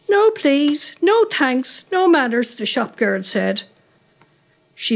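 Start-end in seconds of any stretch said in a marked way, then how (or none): 0.1-2.5 higher-pitched tally of complaints; scolding. A narrator's reading. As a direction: tick off each rudeness sharply, pitched up. Shop girl scolding a rude customer.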